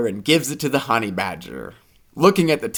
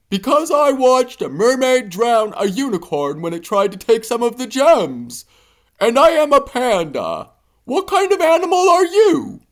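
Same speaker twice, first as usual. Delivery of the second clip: high panda voice; panda's tale; 11 semitones higher than usual. A narrator's reading. Panda to hippo, higher storytelling voice. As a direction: tell the grim story plainly. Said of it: "The panda walks closer ... and says".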